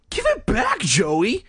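high-pitched, exasperated demand; exasperated sigh. A narrator's reading. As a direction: plead wearily, pitch leaping up. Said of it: "Greg said with a sigh".

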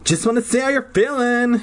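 high, put-on sweet inquiry; Tina's voice. A narrator's reading. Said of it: "she said".

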